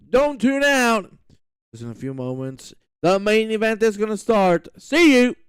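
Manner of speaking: announcer's sign-off to the viewers; show-host send-off; live race commentary. Acted.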